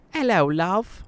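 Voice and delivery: put-on high-pitched English old-lady voice; lilting English accent, pitched high. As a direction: greet like a sweet English granny. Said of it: "She's originally from England".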